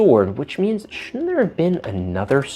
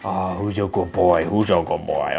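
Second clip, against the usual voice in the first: soft low cooing dog talk; puppy talk; 9 semitones lower, 7 dB quieter than usual. Acted. Praising the toy dog, soft low cooing. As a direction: coo at him gently.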